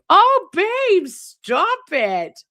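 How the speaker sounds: high, loud, coy oh babe, stop it; flattered gush. Real, not acted. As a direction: squeal like you're blushing at a compliment. Flattered reaction to kind message.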